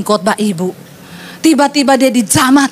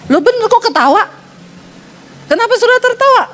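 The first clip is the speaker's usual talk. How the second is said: mock surprised; 9 semitones higher, faster than usual. Real, not acted. Preacher reacts to laughing audience.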